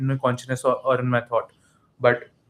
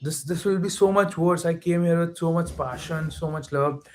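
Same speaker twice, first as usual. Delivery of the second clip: higher-pitched, drawn-out lament; retold dismay; 4 semitones higher than usual. Fan thinking Argentina may lose after coming so far, retold dismay. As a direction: plead against the heartbreak of losing. Acting out fear of losing.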